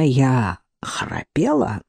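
slow, drowsy whisper; sleepy whisper. Acted. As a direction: ask it half-asleep, barely voiced. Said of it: "сонно спросила она".

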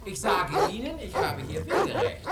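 angry insistence. Acted. Neighbour yells at the woman about his right of way, angry and loud. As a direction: shout the right of way at her.

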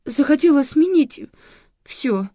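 very high, upset cry; distressed, pleading confession; near tears. A narrator's reading. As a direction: blurt it as tears rise. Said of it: "чуть не заплакала женщина".

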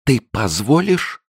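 muffled through her fingers; hand over mouth. A narrator's reading. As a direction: speak through a covering hand. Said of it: "спросила сквозь пальцы".

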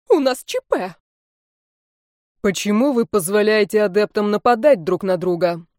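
loud alarm. Acted. Teacher and rector in alarm.

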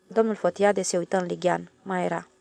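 ordinary delivery, nothing marked

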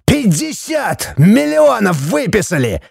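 high-pitched, drawn-out strained outrage; furious roar. A narrator's reading. Judge roaring the fine at wife, furious. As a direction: stretch out the sum in fury. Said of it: "взревел судья".

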